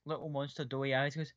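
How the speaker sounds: ordinary talk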